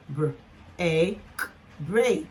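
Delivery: "br", long "a", "k", then "break"; sounded out. Shown as a demonstration. Teacher blending a long-a word for learners, sounded out. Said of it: "So we go".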